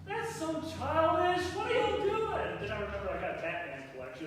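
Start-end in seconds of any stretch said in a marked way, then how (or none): 0.0-2.6 mock scold. Parent scolding daughters mockingly.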